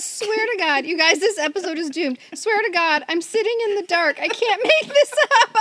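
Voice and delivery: a hint of exasperated guest; voicing the guest's text. Co-host voicing the guest's text about sitting in the dark, half-laughing. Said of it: "She says".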